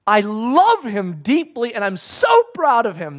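slow, loud, booming voice of the Father; God the Father speaking. Acted. Preacher voicing God at the baptism, slow and booming. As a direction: let it ring out slowly, proud and loving. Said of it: "He said".

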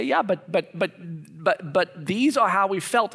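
lightly voiced employee pushback; mock objection. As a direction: play the hesitant critic. Voicing staff pushing back.

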